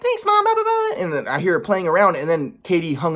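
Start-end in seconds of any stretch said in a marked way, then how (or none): 0.0-1.0 put-on very high little child voice; toddler voice. Father imitates toddler asking mom, high child voice. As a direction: squeak it like a small kid. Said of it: "Mila's like".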